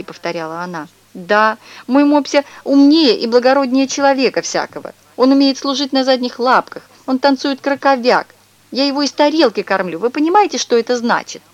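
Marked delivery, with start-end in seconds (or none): 1.1-11.4 higher, louder put-on lady's voice; indignant fussy lady. A narrator's reading. Neighbour brags to mother about her pug, higher and louder. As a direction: gush over the pug in a put-on voice.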